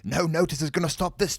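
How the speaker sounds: high, loud bravado; bold boast. A narrator's reading. Dean boasting before the bank door, bold. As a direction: declare it big and loud.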